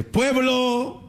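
high, slow orator proclaiming to the people; rally the crowd like a priest-hero; mock heroic proclamation, high and drawn out; rousing speech. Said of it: "se pone a gritar".

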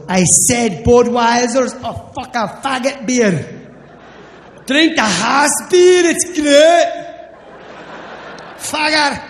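loud, slurred Scottish bartender; high, barely intelligible drunken rant. Retold or imitated. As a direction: bellow thickly like a drunk Scot. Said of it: "The bartender is shit-faced".

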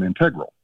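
integral said as in-TEG-ral; stress on second syllable. Retold or imitated. Caller reporting the college students' pronunciation, integral as in-TEG-ral. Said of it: "every one of her employees says".

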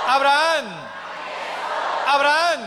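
loud, high call to the crowd; ringing preacher's call-out. Acted. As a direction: shout the name out high, rallying a response. Preacher calls out to congregation.

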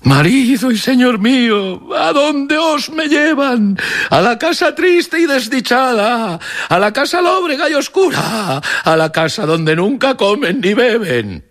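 keen over the dead husband, high and drawn out; wailing widow. A narrator's reading. A widow follows the coffin, crying aloud to her dead husband. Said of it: "que a grandes voces iba diciendo".

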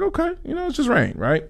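high, breezy mock nonchalance; carefree thought. Cohost mimicking his carefree self before the trip, high and breezy. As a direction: brush it off in a high airy voice. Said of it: "I was like".

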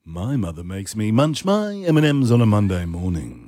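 low, smooth sultry anchor; sultry newsreader voice. Shown as a demonstration. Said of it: "I've got Sandra Sultry going on".

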